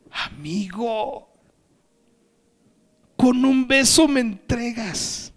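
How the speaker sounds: drawn-out, high-pitched, pained address; voicing Jesus betrayed, slow and high; Jesus to Judas. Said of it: "Y le dice Jesús".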